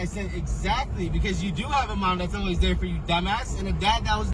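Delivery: high furious yelling; angry shouting. Real, not acted. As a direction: shout back in a rage. Screaming fight continues.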